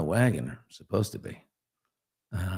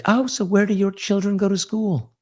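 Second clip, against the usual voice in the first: high-pitched imitation of a polite woman; imitating ex-wife; 12 semitones higher, 3 dB louder than usual. Ex-wife asking [name] about her children, high imitated voice. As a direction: put on a high voice for small talk. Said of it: "Sydney was like".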